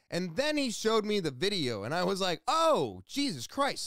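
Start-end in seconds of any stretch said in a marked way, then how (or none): 2.5-3.8 shocked. Retold or imitated. Seeing the nose camera video.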